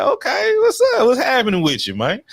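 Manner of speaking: loud squeaky falsetto hello; high mock greeting. To Stacy, loud high mock greeting.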